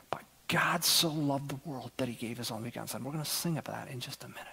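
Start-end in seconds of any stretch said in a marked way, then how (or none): 0.1-3.0 hushed scripture, voiceless; whisper. Real, not acted. Pastor quoting John to the congregation, whispered. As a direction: whisper the verse like a treasured secret.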